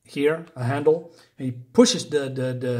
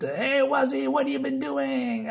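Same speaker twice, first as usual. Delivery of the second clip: put-on drunken calling out; 11 semitones higher than usual. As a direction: imitate a drunk man hollering to his mate, voice pitched way up. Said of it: "he calls to them".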